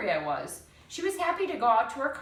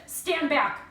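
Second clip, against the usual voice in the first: sharp warning; 6 semitones higher, 6 dB louder than usual. A narrator's reading. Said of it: "Missus Ivy said".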